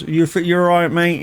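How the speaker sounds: cheery comedian greeting. Retold or imitated. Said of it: "he says".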